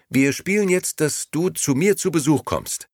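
put-on a little girl inviting a game; playful young girl. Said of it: "schlug Momo vor".

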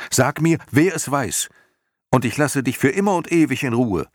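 high, loud, urgent bargaining; Atreju insisting. A narrator's reading. Boy promising Morla peace for an answer, insistent high cry. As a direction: press hard, speeding up into the promise. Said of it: "beharrte Atreo".

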